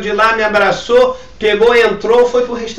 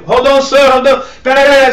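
raised, higher call to a colleague; urgent calling out; 6 semitones higher, 6 dB louder than usual. Retold or imitated. Pastor re-enacts calling Rodolfo to wait, urgent calling out. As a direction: re-enact calling someone to hold on.

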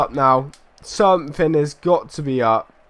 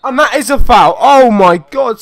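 outraged protest; 8 semitones higher, 8 dB louder than usual. Real, not acted. Player disputing a foul decision, outraged. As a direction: wail at the unfair call.

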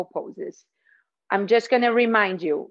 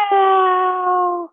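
high, loud little meow; cat meow; 8 semitones higher, 6 dB louder than usual. Shown as a demonstration. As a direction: mew like a tiny cat. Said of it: "tiny little cat".

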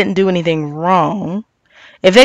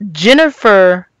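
ordinary talk, nothing marked

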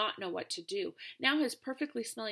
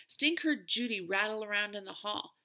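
ordinary delivery

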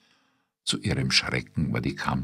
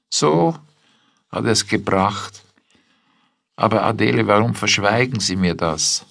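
surprised; 6 semitones higher, 5 dB louder than usual. A narrator's reading. Wife learns it was returned.

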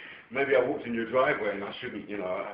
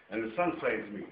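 usual speaking voice

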